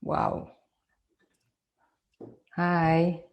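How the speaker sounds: usual speaking voice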